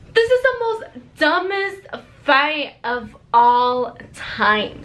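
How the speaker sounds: "dumbest fight of all time" drawn out slowly; emphatic exasperation. Real, not acted. Vlogger introducing embarrassing second fight, emphatic exasperation. Repeats her verdict with emphasis.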